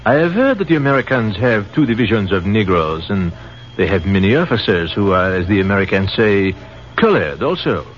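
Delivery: put-on musing, in a light French accent; French captain explaining, in a light French accent.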